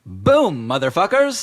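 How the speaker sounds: high loud brash shout; triumphant shout. Acted. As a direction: burst in shouting.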